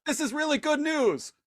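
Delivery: high voice with loud shouted "Go"; a giddy henchman shooing them off; hammed-up eager guard. Guard giddily shooing, high with shouted go. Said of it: "the guy is just like".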